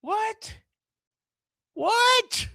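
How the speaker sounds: squeaky high disbelieving what; incredulous. Real, not acted. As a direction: yelp it out in disbelief.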